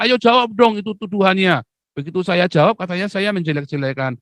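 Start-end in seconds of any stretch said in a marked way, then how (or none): 0.0-1.6 mock crowd demand. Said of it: "nanti kalian semua teriak".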